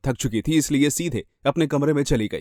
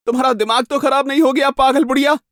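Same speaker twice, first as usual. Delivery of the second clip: high, raised, indignant outburst; angry retort; 10 semitones higher, 5 dB louder than usual. Acted. Woman answering her crazed attacker, angry and high. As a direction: throw the insult back loudly.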